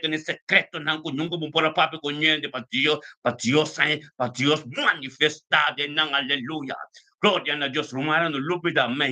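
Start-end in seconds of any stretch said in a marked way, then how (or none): none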